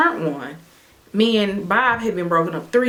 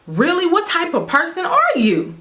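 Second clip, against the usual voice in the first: outraged disbelief; 10 semitones higher than usual. Retold or imitated. She confronts ex over nephew, high outraged disbelief. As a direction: rise up in disbelief.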